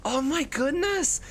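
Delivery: very high, gushing fake surprise; drawn-out, hammed-up high flattery; mock amazed praise. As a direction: gush as if they thought of it. Feigns surprise, flatters chat's choice.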